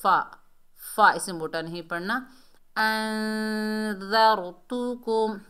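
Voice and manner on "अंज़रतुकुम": slow tajweed chant; recited slowly. Shown as a demonstration. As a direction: draw the syllables out. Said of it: "Hamza को नून से मिलाएंगे".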